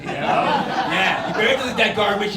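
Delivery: loud, fast taunt; gloating. Acted. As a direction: rub it in their face. Gloating at online opponent.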